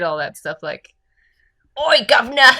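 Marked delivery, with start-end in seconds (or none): 1.8-2.5 loud, high mock Cockney shout; hammed-up Cockney chimney sweep calling out; Cockney accent.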